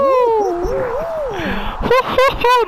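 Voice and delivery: high, loud whooping laugh; relieved laughter. Real, not acted. Player qualified at the last moment, relieved laughter.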